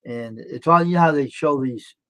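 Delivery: ordinary talk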